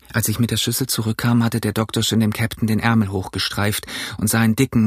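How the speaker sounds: ordinary delivery, nothing marked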